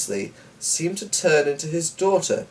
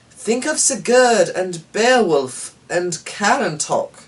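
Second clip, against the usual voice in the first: high, louder, slow eager exclaim; 9 semitones higher, 4 dB louder, slower than usual. A narrator's reading. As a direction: fire him up with heroes' names. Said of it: "exclaimed Eleanor".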